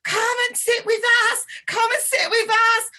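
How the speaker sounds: high, loud, gushing American delight; excited Americans. Retold or imitated. Americans on the kibbutz begging to hear the accent, high and excited. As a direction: mimic thrilled Americans calling someone over. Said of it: "it was all these Americans. They went".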